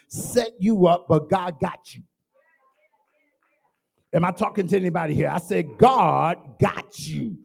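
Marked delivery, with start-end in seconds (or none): 5.8-7.4 "God got you" slowed right down, each word landed; emphatic slow God got you. Shown as a demonstration. Preacher drilling the phrase into the congregation, slow and emphatic. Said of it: "puts an emphasis on it".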